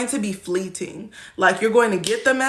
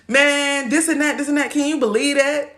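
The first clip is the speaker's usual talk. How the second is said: higher-pitched venting to a buddy; animated, raised-pitch griping; gossiping friend; 6 semitones higher than usual. Acted. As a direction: vent like a friend spilling drama. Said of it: "That's the way they gonna talk to you".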